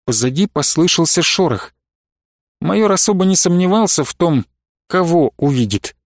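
ordinary delivery

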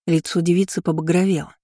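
ordinary delivery

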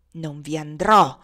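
slow, loud, heavy delivery; stubborn refusal. A narrator's reading. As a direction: dig in and refuse, word by word. Legré refuses with a curse. (rifiuto ostinato, lento e forte)

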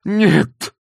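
high, loud cry of refusal; exclaiming Lokhankin. A narrator's reading. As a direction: cry out in protest. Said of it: "воскликнул Лоханкин". (громкий протестующий возглас)

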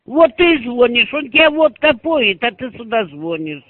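ordinary delivery

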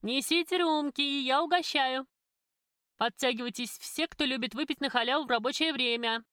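loud, generous announcement to the whole crew; loud call. A narrator's reading. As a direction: call everyone over, loud and high. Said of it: "громко закричала".

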